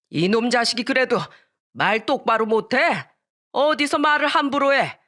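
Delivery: high, forceful reprimand; scolding grandfather. Acted. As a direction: berate the insolent child.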